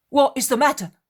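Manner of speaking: Peter shouting high over the storm; alarmed shout. Acted. Peter shouts to the sailor over the storm, alarmed. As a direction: call out loud and sharp above the wind.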